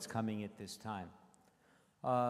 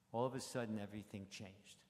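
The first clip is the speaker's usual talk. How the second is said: usual speaking voice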